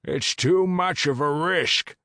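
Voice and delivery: high, slow, loud dragon warning; cautioning dragon. Acted. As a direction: warn him gravely as a dragon. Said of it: "Adventus cautioned him".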